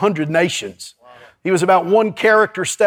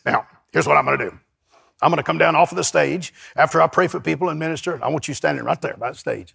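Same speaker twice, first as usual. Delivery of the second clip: put-on lower, softer old preacher voice, hinted; imitating Sumrall; 5 semitones lower, 4 dB quieter, faster than usual. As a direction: quietly give orders as the old preacher. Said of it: "he talked like this".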